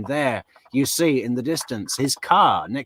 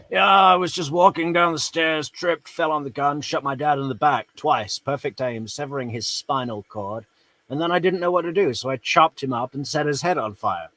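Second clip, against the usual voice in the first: breezy, offhand voice of a killer making excuses; mock casual killer; 3 semitones higher than usual. Commentator mocking the killer's fall excuse, breezy mock voice. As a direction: toss off the excuse like tripping on stairs.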